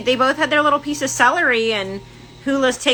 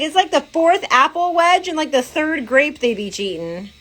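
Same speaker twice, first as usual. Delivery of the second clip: quoting Joey; 6 semitones higher than usual. Retold or imitated. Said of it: "He's like".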